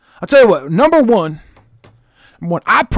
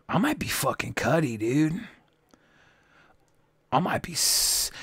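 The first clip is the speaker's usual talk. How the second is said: whispering; 5 semitones lower, 15 dB quieter than usual. Real, not acted. Lowered, half-whispered boast.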